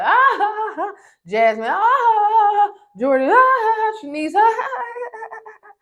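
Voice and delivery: high, loud, hammed-up laughing; mock their gloating laughter; mock cackling laughter. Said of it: "they thought it was so funny, like".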